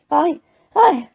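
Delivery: squeaky, very high elf voice; gasping elf. A narrator's reading. Frightened elf denies it to Diggory, gasping squeak. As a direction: plead in a panicked little squeak. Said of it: "Winky gasped".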